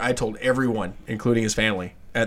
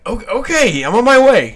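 startled okay; 9 semitones higher, 7 dB louder than usual. Retold or imitated. Speaker's startled reply retold.

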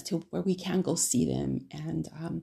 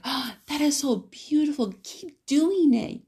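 gushing grandma; 8 semitones higher than usual. Retold or imitated. Grandma praises her granddaughter's knitting, higher and gushing. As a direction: lay it on like a proud grandma. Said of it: "my mom would be like".

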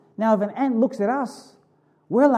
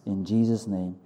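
fervent, earnest pastor's prayer; 7 semitones lower than usual. Acted. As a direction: pray it out boldly to God. Leads the congregation in prayer.

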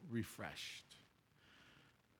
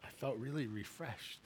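soft quote; 3 semitones lower, 12 dB quieter than usual. Retold or imitated. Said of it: "She said".

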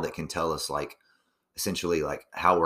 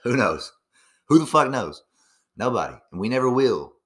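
louder and higher, exasperated outburst; loud exasperation; 6 semitones higher, 6 dB louder than usual. Real, not acted. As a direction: throw the question out loud in frustration. Ranting about unknowable answers.

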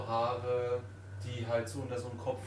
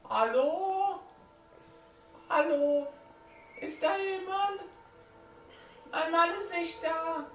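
put-on high, wary old farm woman; alte Bäuerin; 6 dB louder than usual. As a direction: call out like a nervous old farmer's wife. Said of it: "hört vom Bauernhaus auf einmal". (ängstliche alte Bäuerin)

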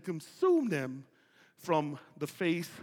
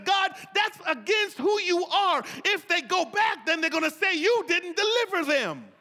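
loud, high, shouted plea; loud, high shouted prayer; 12 semitones higher, 5 dB louder than usual. Retold or imitated. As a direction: cry out the argument to God. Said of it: "the prayer says".

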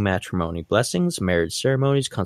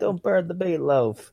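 mock mom; 8 semitones higher than usual. Mock mom's reply.

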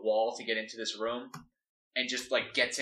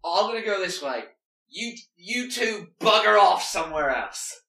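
put-on high loud British accent, dismissive; 7 semitones higher, 9 dB louder than usual. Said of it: "He's a British thief".

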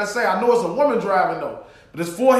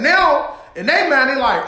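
normal talking voice

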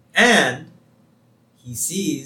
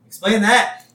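loud, raised mock challenge; Homer; 8 semitones higher, 8 dB louder, slower than usual. Homer challenges Lisa over the goo, loud mock triumph. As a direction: demand it triumphantly, imitating a cartoon dad. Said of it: "And Homer says".

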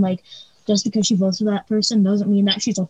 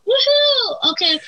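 much higher gleeful whoop; excited cheer; 13 semitones higher, slower than usual. Retold or imitated. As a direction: whoop like school's out. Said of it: "I was like".

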